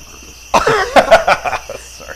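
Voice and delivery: laughing through a confession. Real, not acted. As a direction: giggle the words out slowly.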